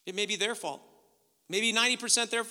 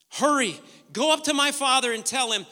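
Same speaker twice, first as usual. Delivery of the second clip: raised, loud acted urging; urgent command; 6 semitones higher, 9 dB louder than usual. Joseph sending his brothers to his father, urgent command. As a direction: send them off with urgency.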